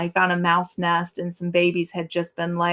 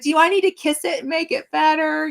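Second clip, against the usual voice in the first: higher, coddling question to a grown son; baby-talk mom; 9 semitones higher than usual. Retold or imitated. Mom teasing her grown son about his cut, coddling baby talk. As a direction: coddle him like a toddler with a scrape. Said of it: "you're acting like that".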